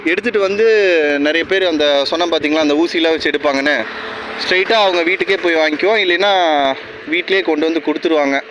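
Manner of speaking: normal talking voice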